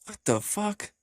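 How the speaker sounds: high-pitched mock-baffled reaction; incredulous, acted-out what the fuck; retold bafflement. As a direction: replay your disbelief with the pitch jumping up.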